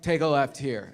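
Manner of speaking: low, offhand instruction; understated drunk navigator; drunk friend. Acted. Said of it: "Waze is your drunk friend".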